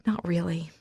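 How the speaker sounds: whisper. Acted. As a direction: breathe it out, hurt. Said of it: "I whisper".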